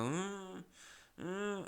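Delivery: high, soft closed-mouth whimper; imitated high "mm" from a sleeper; muffled sleep moan. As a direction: hum a stifled cry for help. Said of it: "seeing me rock back and forth and go".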